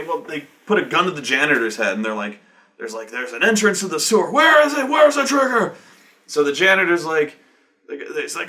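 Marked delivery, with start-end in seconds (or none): 3.1-5.8 high shouted demand at the janitor; mock threat. Runner shouting at the janitor at gunpoint, mock yell. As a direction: bark it like a gun is at his head.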